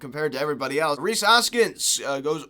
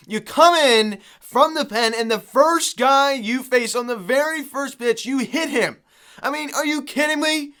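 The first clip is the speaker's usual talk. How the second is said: exasperated rant; 7 semitones higher, 4 dB louder than usual. Real, not acted. Fan fuming at reliever's hit batter.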